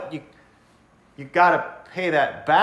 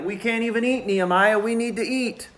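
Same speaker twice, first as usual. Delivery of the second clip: lightly voiced whining worker, higher; whiny complaint; 5 semitones higher than usual. Hungry workers whining to Nehemiah, mild mock plea. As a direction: plead a little with Nehemiah. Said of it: "they're starting to cry and complain".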